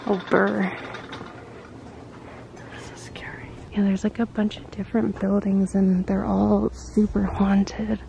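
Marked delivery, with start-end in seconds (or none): 2.8-3.5 hushed, scared whisper; nervous night vlog whisper. Real, not acted. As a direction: breathe it out nervously. Scared remark in haunted place.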